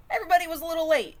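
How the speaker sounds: fast, high, loud brush-off; rushed cheer. Acted. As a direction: rush it out, pretending it's fine. Forced optimism waiting for guests.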